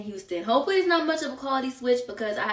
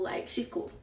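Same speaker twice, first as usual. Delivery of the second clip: whispering; 10 dB quieter than usual. Real, not acted. Aside vouching for the girl.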